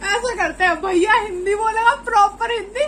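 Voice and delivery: laughing. Real, not acted. Laughing while proposing challenge.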